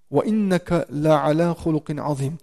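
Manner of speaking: quick, flowing recitation of the verse; Quran recitation. Acted. Preacher reciting the verse to students, quick and flowing. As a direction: recite it fast before explaining it.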